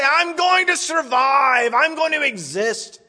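high, slow mock proclamation; mocking wrong version. Preacher showing what Habakkuk did not say, high mock voice. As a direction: lampoon a merely surviving faith. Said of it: "He didn't say".

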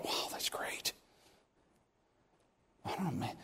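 hushed unvoiced whisper of a private thought. Pastor telling his congregation his secret thrill, whispered. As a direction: breathe it under your breath, amazed. Inner thought after job offer.

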